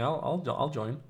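talking between sips of tea; words half into the teacup; sipping hot tea. Real, not acted. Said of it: "I'm sipping my tea now".

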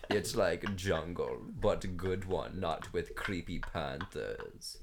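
low, slow put-on fan character; mock fan voice. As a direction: explain it slowly and deep, like a foreign fan. Mock foreign fan describing, broken English.

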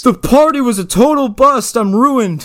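high, loud mock whine; whiny partygoer. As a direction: wail it like a spoiled kid.

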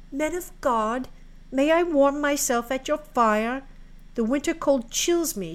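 put-on high, imploring girl voice; pleading maiden. Maiden asks the months for warmth at their fire, pleading. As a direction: beg politely for shelter, pitched high.

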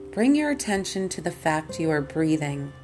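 ordinary talk

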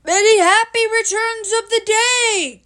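high, loud cheery call; high-pitched Pooh calling out loudly. A narrator's reading. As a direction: shout a birthday greeting across the river. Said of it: "called out Pooh".

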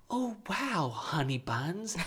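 high, soft, drawn-out mock sympathy; fake validation. Husband pretending to validate wife's feelings, syrupy fake sympathy. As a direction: coo slowly with put-on understanding. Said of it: "he gives this impression of, of validating her feelings".

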